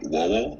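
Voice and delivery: "Wowel" with W replacing L and R. Shown as a demonstration. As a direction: demonstrate "Wowel", all rounded lips, no L. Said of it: "with L and R being a W".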